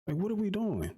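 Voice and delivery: can't believe this list; exasperated. Real, not acted. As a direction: pitch jumps up in outraged disbelief. Exasperated rant about the list.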